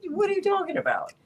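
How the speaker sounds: high, quick burst of disbelief; scoffing at a ridiculous claim; incredulous. Retold or imitated. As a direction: toss it out fast and high, baffled.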